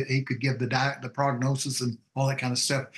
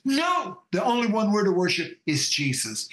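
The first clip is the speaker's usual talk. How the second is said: defiant refusal; 6 semitones higher than usual. Retold or imitated. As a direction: shout down the devil. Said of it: "said to this voice".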